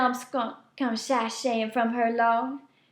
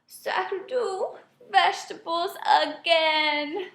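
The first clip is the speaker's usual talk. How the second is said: mild moan; 6 semitones higher, slower than usual. A narrator's reading. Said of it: "he wipes away his tears and moans".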